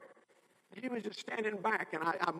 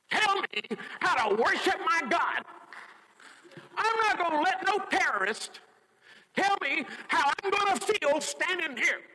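mama preaching; 7 semitones higher, 4 dB louder than usual. Retold or imitated. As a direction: preach it, building each line. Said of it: "Mama started preaching".

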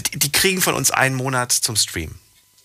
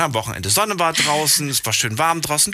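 ordinary talk

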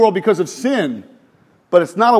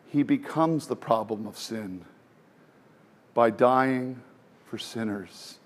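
much softer, slower and lower solemn sermon voice; hushed preaching; 6 semitones lower, 8 dB quieter than usual. Acted. Preacher to congregation about the cross, hushed and slow. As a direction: drop to a near hush as if telling a sacred secret.

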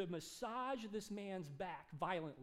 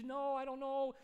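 high, distressed rush of upset; lightly acted wailing, pitched up; crying wife, imitated; 7 semitones higher, 4 dB louder than usual. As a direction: imitate a wife tearfully lamenting. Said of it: "his wife starts crying".

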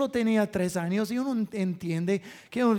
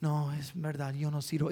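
put-on defeated boy; 6 semitones lower, 10 dB quieter than usual. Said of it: "Y él".

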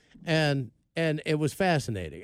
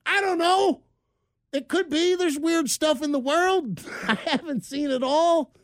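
mock defensive; 13 semitones higher than usual. Husband imitating himself defending the creature to his wife, high and sheepish. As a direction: squeak out a flustered defense. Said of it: "And I'm like".

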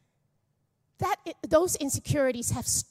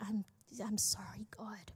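hushed, breathy whisper to God; whispered confession; 2 semitones lower, 13 dB quieter than usual. Retold or imitated. Pastor whispering a remembered apology to God.

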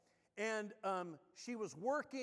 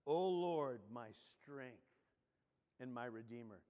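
solemn prayer read aloud; prayerful scripture reading; 4 semitones lower than usual. Acted. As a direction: pray the psalm as you read it, unhurried. Reads Psalm nineteen verse.

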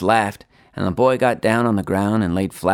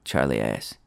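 ordinary delivery, nothing marked

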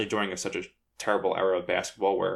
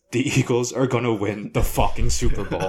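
retold shout of joy, a touch louder; dad's big yell; 4 semitones higher, 6 dB louder than usual. Dad grabbing his son after the game, shouting with joy. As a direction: belt it out like an overjoyed dad mid-hug. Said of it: "grabs me and goes".

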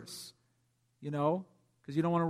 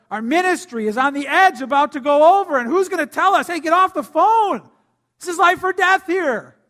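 much louder, higher urgent cry; impassioned preaching; 9 semitones higher, 11 dB louder than usual. Real, not acted. Pastor urging the church to hear warnings, loud and high. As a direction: sound the alarm to the congregation.

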